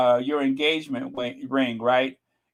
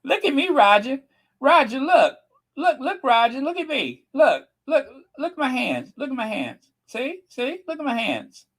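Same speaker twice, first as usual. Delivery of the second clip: high, insistent pleading to Roger; hammed-up nagging wife voice; mock pleading; 9 semitones higher than usual. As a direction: beg him to look, pitched way up. Reenacting Kate pleading again.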